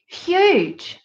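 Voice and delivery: high drawn-out Australian huge; a quick Australian accent. Shown as a demonstration. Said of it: "that was my attempt at Australian accent".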